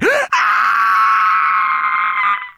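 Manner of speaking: long, high screech; furious bird cry. A narrator's reading. Mother rock answering her screeching chick, furious high cry. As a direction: screech like an enraged giant bird. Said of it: "responded with a furious cry".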